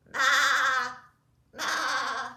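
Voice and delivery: goat bleat. Shown as a demonstration. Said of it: "Should we practice those sounds together".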